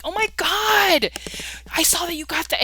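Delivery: put-on high, loud, vapid Valley Girl gush. Mocking a coworker's text in a Valley Girl voice. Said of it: "almost like Valley Girl".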